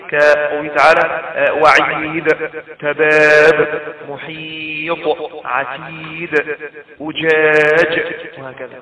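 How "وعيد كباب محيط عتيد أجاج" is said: slow chanted words, وعيد to أجاج, each last letter bounced; middle qalqalah. Shown as a demonstration. Teacher listing paused examples like محيط, final letter echoed. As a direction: stretch each long vowel, rebound the د in عتيد and ج in أجاج. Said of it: "أوسطها أوسط القلقلة وهو الساكن الموقوف عليه".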